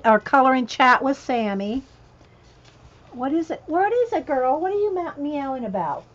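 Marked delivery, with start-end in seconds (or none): 3.1-6.0 high sing-song voice to a cat; pet talk. Real, not acted. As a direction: coo at the cat. Talking to meowing cat.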